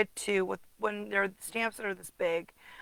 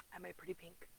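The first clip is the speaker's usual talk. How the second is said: whisper; 2 semitones lower, 20 dB quieter, faster than usual. Real, not acted. Quiet aside about her cup.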